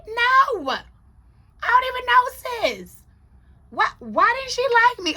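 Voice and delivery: high, loud retold protest; hammed-up squeal of bewilderment; baffled protest. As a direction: act out wounded disbelief at full pitch. Acting out own baffled reply.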